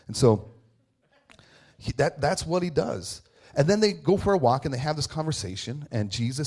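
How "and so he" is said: ordinary talk, nothing marked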